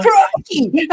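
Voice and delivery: high-pitched Aussie exclamation; playful high Aussie cry; mock Aussie accent. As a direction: echo it in exaggerated Australian. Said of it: "try and do an Aussie accent".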